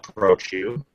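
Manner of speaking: ordinary talk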